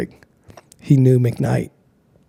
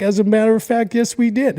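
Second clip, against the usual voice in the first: high knowing mock reply; 9 semitones higher than usual. Bosses answering knowingly.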